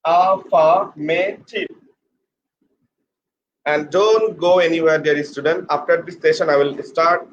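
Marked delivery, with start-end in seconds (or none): 0.0-1.7 slow, spaced-out AFFIRMATIVE; syllable by syllable. Shown as a demonstration. Teacher showing the dear student how it should be, syllable by syllable. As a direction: say AFFIRMATIVE in four parts, a-fa-ma-tiv. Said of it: "The pronunciation should be".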